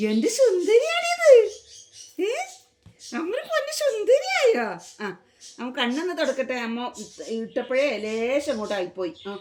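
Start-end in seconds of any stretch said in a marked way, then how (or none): 0.1-2.3 slow, loud, high-pitched cooing. Real, not acted. Mother admiring her pretty little one, high gushing baby talk. 3.2-4.7 very high sing-song coo. Real, not acted. Mother asking if her darling is pretty now, high doting baby talk.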